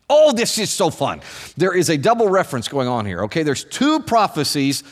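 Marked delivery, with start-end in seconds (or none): 0.1-1.2 real delight, high opening burst; gleeful excitement. Real, not acted. Preacher thrilled by the prophecy's double reference, gleeful. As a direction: burst out higher, relishing it.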